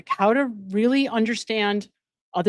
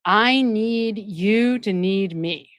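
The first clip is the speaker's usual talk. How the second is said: slow emphasis; much slower than usual. Shown as a demonstration. Defining codependency, slow emphasis.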